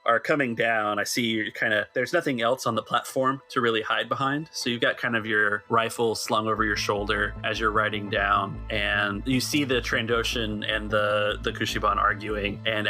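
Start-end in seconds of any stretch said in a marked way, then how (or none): none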